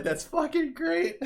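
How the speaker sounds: high laughing, drawn out; laughing at the kid's praise; laughing, slow. Retold or imitated. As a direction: say it slowly through laughter. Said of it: "I was like".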